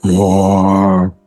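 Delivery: a mock roar, deadpan; lightly voiced bear roar. Transformed druid to the player, a mock bear roar. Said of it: "looks up at you and goes".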